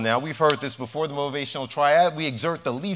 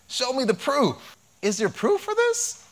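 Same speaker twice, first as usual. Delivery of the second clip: high, slow sceptical voice; doubting audience member; 10 semitones higher than usual. Retold or imitated. Audience member doubting the doctor, high and slow sceptical. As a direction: play the doubter in the crowd, pitched way up. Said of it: "some of you may be saying".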